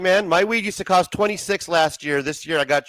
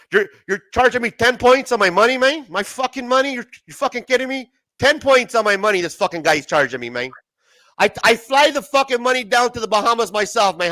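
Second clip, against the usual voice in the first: loud, higher, outraged gangster rant; hammed-up furious gangster; mock mobster rant; 6 semitones higher, 5 dB louder than usual. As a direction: vent like an insulted mobster. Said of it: "riffing Casino mobster rant".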